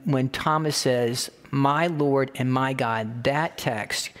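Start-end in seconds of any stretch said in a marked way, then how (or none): 1.5-3.2 "My Lord and my God." drawn out very slowly; slow solemn confession. Acted. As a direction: give each word of "My Lord and my God." its own weight. Thomas's confession again.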